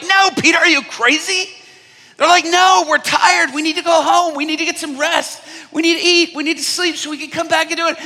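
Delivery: high, loud whining protest; mock crew shouting their complaints; exasperated crew. Tired crew yelling at Peter to go home.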